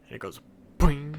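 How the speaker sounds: loud springy boing; boing sound. Retold or imitated. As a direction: bounce the word out. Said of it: "You know when you kick a kickball".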